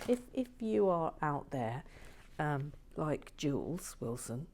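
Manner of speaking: usual speaking voice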